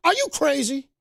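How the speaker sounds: high, lightly voiced coach scolding; a hint of the stern coach; Coach Carter impression. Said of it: "he goes".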